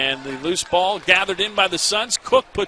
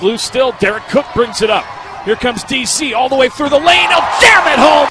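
building from a louder call to a high, full-throated shout; excited play call; 9 semitones higher, 10 dB louder than usual. Real, not acted. Announcer calling the drive to the basket, building to a roaring shout. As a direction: ride the play up to a roaring finish.

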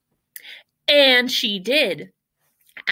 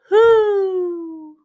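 loud, high, falling hoot; owl hoot; 9 semitones higher, 7 dB louder than usual. Acted. Owl tries to crow, long high hoot. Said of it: "Owl tried a cock-a-doodle-doo".

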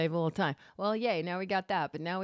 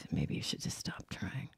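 breathy, undermining whisper, much softer and lower; hushed whisper; 11 semitones lower, 7 dB quieter than usual. Retold or imitated. Imitating an unsupportive loved one, whispering doubt. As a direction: whisper the discouraging line like a quiet poison. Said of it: "Like that, that comes out".

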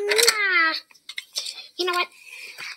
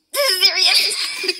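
laughing; slower than usual. Real, not acted. A child insisting it's serious while laughing. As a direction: try to sound serious but laugh. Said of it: "I'm laughing too much".

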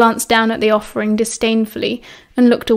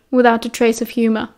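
plain reading voice

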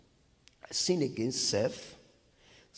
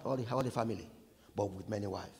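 ordinary talk

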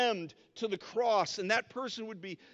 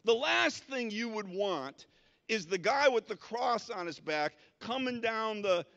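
normal talking voice

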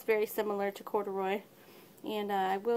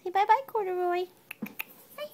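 high, louder sing-song farewell; 9 semitones higher, 4 dB louder than usual. Real, not acted. Owner making the kitten say bye, high baby talk. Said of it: "You make me talk baby talk".

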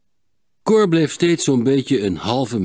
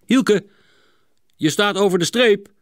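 higher-pitched, louder warning call; 7 semitones higher, 4 dB louder than usual. A narrator's reading. Klinkhamer warns Hielke at the starting line, warning. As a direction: warn a racer who has crept forward. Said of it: "waarschuwde Klinkhamer".

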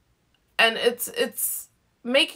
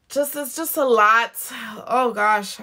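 ordinary delivery

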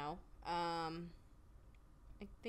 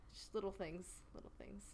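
whispered mutter; 13 dB quieter than usual. Real, not acted. Trailing self-muttered complaint.

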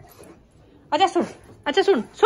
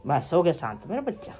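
soft soothing; 10 semitones lower, 6 dB quieter than usual. Real, not acted. Calming the pet.

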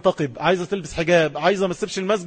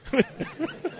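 high laugh; 11 semitones higher, 7 dB quieter than usual. Real, not acted. Laughing at retold story.

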